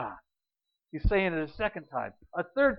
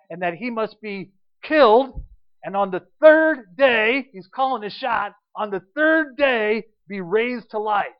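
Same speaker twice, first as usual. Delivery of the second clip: loud, slow, swelling declaration; preaching at full force; 3 semitones higher, 8 dB louder, slower than usual. Acted. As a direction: drive home the third day. Preaching the resurrection prophecy.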